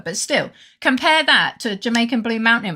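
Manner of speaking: usual speaking voice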